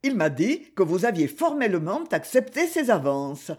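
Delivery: ordinary delivery, nothing marked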